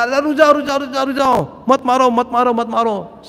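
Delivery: high-pitched, urgent repeated call; urgent halting cry. Acted. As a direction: call off the attackers in a hurry. Demon urgently halts the attack. (ऊँची आवाज़ में हड़बड़ाकर रोकते हुए)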